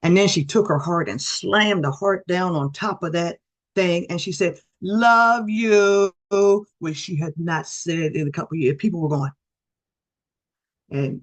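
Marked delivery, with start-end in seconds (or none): 4.8-6.1 higher, louder burst of love; a wife finally saying it again, loud and high; retold love you. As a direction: blurt it out, pitched up and louder. Wife surprising husband after years.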